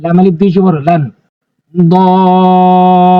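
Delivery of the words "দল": দল with the vowel drawn out long before the stop; four-alif stretch on দল; long madd stretch. Shown as a demonstration. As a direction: recite দল slowly, holding the vowel. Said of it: "এভাবে টেনে পড়বেন".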